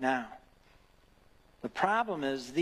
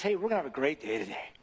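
quick, breezy pep-talk voice; cheerful Jesus; much faster than usual. Retold or imitated. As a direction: toss it off like a buddy in a baseball cap. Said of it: "Jesus at the foot of my bed".

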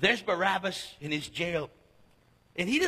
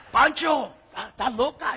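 laughing call; 6 semitones higher, 8 dB louder than usual. Retold or imitated. Young man laughing to his friend.

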